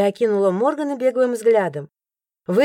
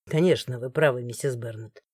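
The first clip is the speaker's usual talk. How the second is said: lower, softer, firm male voice; guard interrupts; 9 semitones lower, 6 dB quieter than usual. A narrator's reading. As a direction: cut in low and firm. Morgan, the guard, speaks. (низко и твёрдо перебивает)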